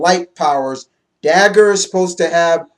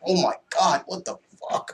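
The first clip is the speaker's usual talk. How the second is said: hushed, higher mock dismay; stunned retold exclamation; 3 semitones higher, 7 dB quieter than usual. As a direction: imitate someone reeling in breathless shock. Voicing the stunned, injured Banshee.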